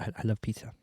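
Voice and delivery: whispered movie quote. Retold or imitated. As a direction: breathe the line under your breath. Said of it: "my favorite thing to say".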